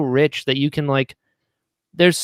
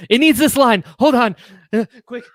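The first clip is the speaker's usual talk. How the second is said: hurried, higher panicked voice; frantic self; 7 semitones higher, 4 dB louder than usual. Retold or imitated. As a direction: rush it out like a panicking writer in the booth. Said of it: "be like".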